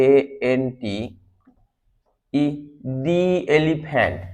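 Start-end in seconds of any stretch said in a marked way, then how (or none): 3.1-4.2 very slow, "the" as "dee"; slow demo. Shown as a demonstration. Teacher slowly demonstrating "the elephant". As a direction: drag each word out slowly. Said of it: "demo of দি before vowel".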